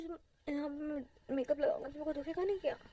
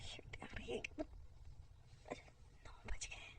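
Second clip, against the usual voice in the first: whisper; 11 dB quieter than usual. Acted.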